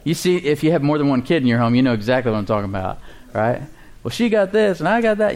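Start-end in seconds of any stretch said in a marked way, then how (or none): 4.0-5.3 put-on "Well, she got this and I got that" in a high, childish voice; high mock kid complaint; whiny kid. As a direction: mimic a squabbling child. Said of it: "kids fight over who gets what".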